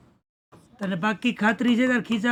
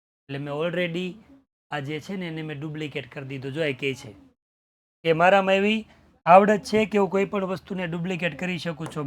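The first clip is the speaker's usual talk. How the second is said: ordinary delivery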